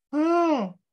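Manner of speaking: a tiny voice caught off guard; subconscious voice. Shown as a demonstration. Coach's squeaky subconscious starting to waver. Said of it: "use my little funny voices".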